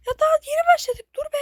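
high, loud, fast protest; "wait, we just started" yelp; panicked protest. Real, not acted. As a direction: blurt it out in alarm. Game punishes right away.

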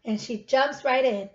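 ordinary delivery, nothing marked

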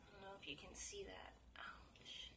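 fast, hushed whisper. Real, not acted. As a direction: murmur it under the breath. Whispering over the welts.

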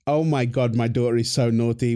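usual speaking voice

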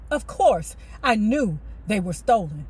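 usual speaking voice

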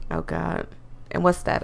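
usual speaking voice

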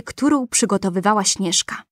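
ordinary delivery, nothing marked

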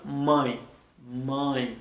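"man" with a nasalized vowel; nasal sound. Shown as a demonstration. Teacher giving tilde example words, nasal sound. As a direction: say "man" through the nose, held alone. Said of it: "dezyèm son ki ou kapab tande".

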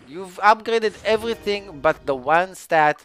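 high, loud indignant complaint; exasperated rant. Real, not acted. Gamer demanding crit damage from the game, exasperated rant. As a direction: protest at full volume. Rants about the wrong stat.

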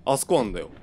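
ordinary talk, nothing marked

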